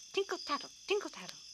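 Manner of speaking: high tinkle tattle; jingling. A narrator's reading. Narrator jingling the copper pennies. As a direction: ring it like coins.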